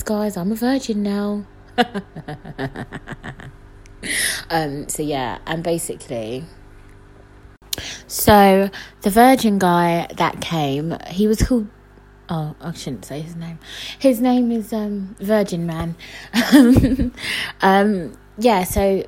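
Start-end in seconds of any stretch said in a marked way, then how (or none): 1.8-3.5 long, low, soft chuckle; amused giggle. Real, not acted. Laughs at her virgin joke. 16.0-17.6 bursting giggle; amused laugh. Real, not acted. Laughs at the made-up name.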